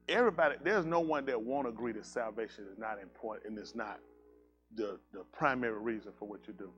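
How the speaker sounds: quiet sincere sermon; earnest sermon. Acted. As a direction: lay it out gently to the congregation. Preacher turns to teaching.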